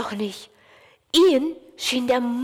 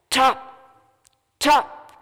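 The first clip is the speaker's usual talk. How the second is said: stamp out each tap; loud stomping taps; 3 semitones higher, 4 dB louder than usual. A narrator's reading. Stomping grows louder.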